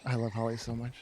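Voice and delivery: low, hushed, doting; a soft, low devoted murmur; soft gushing aside. Real, not acted. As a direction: murmur it fondly toward Holly.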